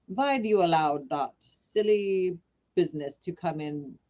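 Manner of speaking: lightly voiced German accent, a touch of scolding. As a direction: grumble like an old German painter. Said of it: "sorry about the accent".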